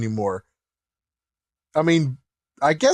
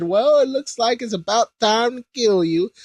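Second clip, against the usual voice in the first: only a slightly raised mock threat; a half-hearted first go at Babidi's line; lightly voiced villain; 7 semitones higher than usual. Babidi gloating over the pinned Kai, lightly voiced. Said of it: "Actually, sorry".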